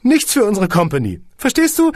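high and loud praise; gushing squeaky boss. A narrator's reading. Boss praises the song, high and loud. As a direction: gush before the catch.

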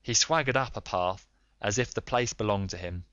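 ordinary delivery

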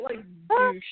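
"douche" punched high and loud; stress on douche. Real, not acted. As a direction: hit "douche" hard, up and loud. Emphatic insult at the villain.

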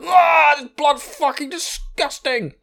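high, drawn-out cry of revulsion; disgusted outburst. Retold or imitated. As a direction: recoil loudly from a foul taste, dragging the words. Bat demon recoils from blood.

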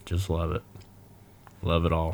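soft, flat droning; deadpan it, barely awake; monotone. Acted. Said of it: "real monotone".

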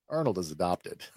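ordinary delivery

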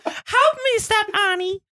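shrieking high falsetto, hammed-up damsel in distress; mock porn-scene falsetto cry for Annie. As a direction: squeal it like a stuck, panicked heroine. Continues falsetto parody.

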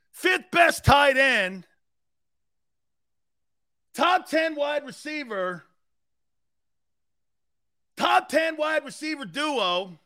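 oversell it until it's obviously a joke; sarcastic hype. Pretend. Host mocking Eagles fans with over-the-top shouted praise. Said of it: "Still sarcasm is on a hundred".

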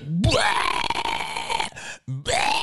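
high, drawn-out retching; imitated vomiting. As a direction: act out violent heaving. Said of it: "It was like".

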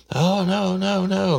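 falsetto panicked woman pleading; high mock wail. Detective imitates the suspect pleading innocence, falsetto whine.

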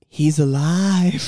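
very slow, low dramatic quote; mock-dramatic reveal, drawn way out; imitated film line. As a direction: stretch it out slowly, pitched low. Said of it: "when she's doing the thing".

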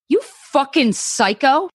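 high, slow and furious. Acted. Susan, horrified, attacking Dan in fury. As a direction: spit the insult out at him.

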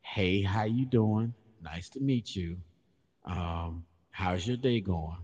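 very slow, measured greeting; polite small talk. Acted. Host greets Barbie girl, slow polite small talk. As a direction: stretch out a put-on courteous hello. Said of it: "I might say".

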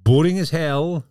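mock groan at the boredom; exasperated emphasis. Real, not acted.

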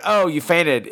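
ordinary talk

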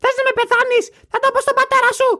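very high, shrill scolding mother; grandma scolding. Acted. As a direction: scold him like a hysterical mother. Greek mother scolding about gun. (υστερική μάνα που μαλώνει)

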